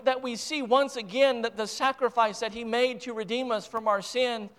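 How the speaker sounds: ordinary talk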